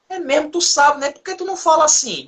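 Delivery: lightly voiced surprised friend; mock surprised friend.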